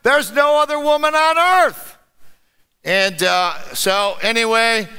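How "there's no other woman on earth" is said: a hint of an exasperated husband, higher; lightly voiced Adam. Adam protesting to Eve, mildly exasperated. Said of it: "He said".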